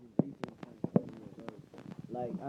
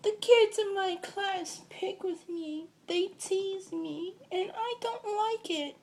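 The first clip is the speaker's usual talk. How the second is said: put-on much higher, slow, small-child voice; little girl voice; slower than usual. Seven-year-old cousin telling him about teasing, high little-girl voice. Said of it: "She's like".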